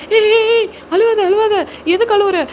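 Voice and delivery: high, urgent acted plea to stop crying; flustered senior, voice shooting way up; alarmed hushing. Senior trying to stop the junior girl crying, alarmed and urgent.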